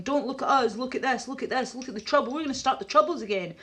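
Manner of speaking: lightly acted frantic misdirection; mock government distraction. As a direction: rattle it off, urgently diverting attention.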